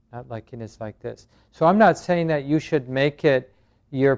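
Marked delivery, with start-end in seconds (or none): 0.1-1.3 much softer, almost to yourself; quietly repeating "Not liking is like this". Shown as a demonstration. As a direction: let the noting fade to a murmur. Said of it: "then to notice the resistance".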